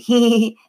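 high, loud dummy giggle; shrieky little "hee-hee". A narrator's reading. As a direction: giggle like a creepy puppet. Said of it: "He giggled again".

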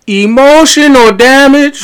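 meme voice. Pretend. Meme catchphrase shouted.

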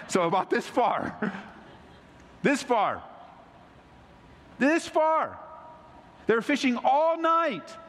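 high, ringing pulpit delivery; fervent sermon voice lifted high; preaching at full force. Acted. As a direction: preach it out to the whole church. Preacher retells the miracle excitedly.

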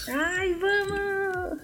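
high, drawn-out eager cry; excited. Real, not acted. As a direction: stretch it out, thrilled. Cheering the trip to Salvador.

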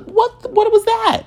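high shocked squeak, far above his own voice; startled shriek. Retold or imitated. Hearing his knees crack behind him, startled high squeak. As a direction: jump at a sudden noise. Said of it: "I was like".

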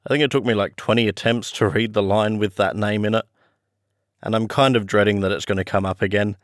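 usual speaking voice

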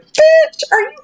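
sharp high exclamation; indignant outburst. Real, not acted. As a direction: blurt it out, outraged. Indignant outburst at retold stranger.

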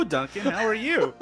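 usual speaking voice

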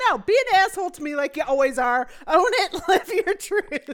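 laughing mock dare. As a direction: dare them through laughter. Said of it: "It's like".

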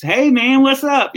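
loose high Southern drawl greeting, imitating himself; Southern accent. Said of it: "I started hearing my Southern accent come back in".

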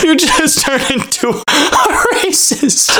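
high, breathless laughing words. Real, not acted. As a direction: laugh through it. Laughing through the joke.

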